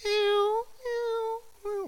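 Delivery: very high squeaky meows; cat meowing. Retold or imitated. The cat asks God in meows, very high. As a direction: mew like a small cat. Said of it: "And the cat says".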